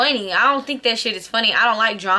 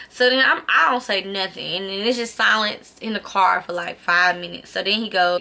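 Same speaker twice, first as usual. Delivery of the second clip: ordinary talk, nothing marked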